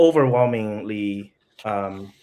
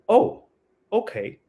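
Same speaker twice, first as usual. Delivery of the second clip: sudden high surprised oh; 11 semitones higher than usual. Retold or imitated. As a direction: caught off guard, pitch jumps up. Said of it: "slight surprise factor from her voice".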